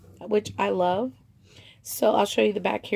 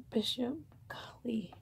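whisper; 2 semitones higher, 11 dB quieter than usual. Real, not acted. Aside to the dog.

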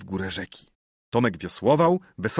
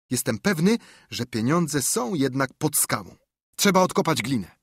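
put-on excited boy, higher pitched; boy with a sudden idea, pitched up; eager boy, higher; 6 semitones higher than usual. Tomek's voice in story.

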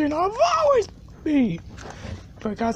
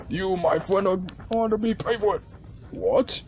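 ordinary talk

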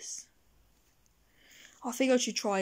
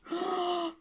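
shocked gasp; 7 semitones higher, 7 dB quieter than usual. Acted. Shocked at insult.

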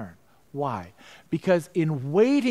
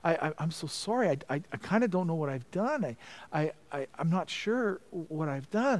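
softer, broken, tearful apology; retold weeping; 7 dB quieter than usual. Praying to God after conviction, softly tearful. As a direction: let the sorry crack a little as you recall crying. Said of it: "I began to weep".

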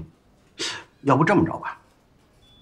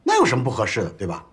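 normal talking voice

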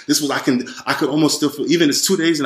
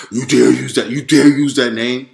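louder, slowed, intimidating roar; heightened roar; 6 dB louder than usual. Retold or imitated. Devil yelling at the speaker for saying Jesus, louder roar. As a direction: roar it down at him like a furious demon. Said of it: "but heightened".

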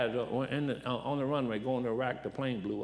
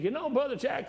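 raised, earnest quoting voice; voicing the old preacher's advice, pitched up; quoted mentor; 11 semitones higher than usual. Retold Brother Pete's words.

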